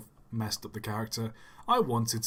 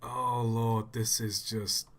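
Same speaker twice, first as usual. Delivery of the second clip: normal talking voice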